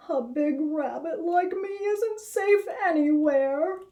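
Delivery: a big rabbit feeling hopeless; weary despair. Acted. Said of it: "with a long, weary sigh of despair".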